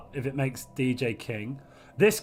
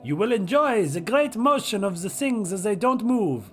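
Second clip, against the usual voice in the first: a hammed-up French waiter accent, higher; silly French accent; 6 semitones higher, slower than usual. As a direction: present the loading screen like a proud French chef. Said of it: "I don't know what that accent is".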